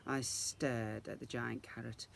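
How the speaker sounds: usual speaking voice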